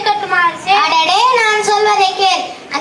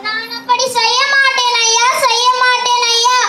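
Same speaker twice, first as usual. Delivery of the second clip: high, whiny voice of a frightened gardener; a poor gardener, higher and wailing in disbelief; a gardener pleading his innocence, high and whiny; panicky gardener; 4 semitones higher than usual. Acted. Gardener refuses Birbal's plan.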